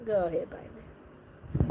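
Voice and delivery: breathy whispered go ahead bite me; low slow whisper inviting the bite; whispered dare. Pretend. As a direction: whisper it like a tease. Reacting to vampire scene, whispered.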